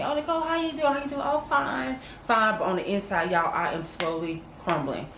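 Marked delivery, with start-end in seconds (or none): 0.0-1.2 higher, bright mock friend asking after her; imitating cheery friends. Friends asking Nicole how she is, lightly imitated cheerful voice. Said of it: "my friends ask me". 1.2-2.6 higher, breezy fake-cheerful answer; faking fine. Hiding her troubles from friends, put-on cheerful reply. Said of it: "acting like".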